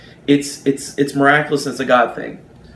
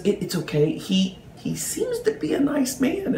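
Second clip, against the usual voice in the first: soft, halting, rising high on the second sentence; hushed reassurance; 10 semitones higher, 5 dB quieter than usual. Retold or imitated. Agent calms angry pilots on the phone, soft imitated reassurance. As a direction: timidly placate them, imitating her small voice.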